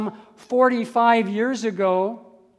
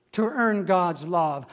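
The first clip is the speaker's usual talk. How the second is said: ordinary delivery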